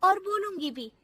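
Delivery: high-pitched, defiant comeback; defiant daughter-in-law. Acted. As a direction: talk back boldly, pitched high. Bahu defiantly talks back.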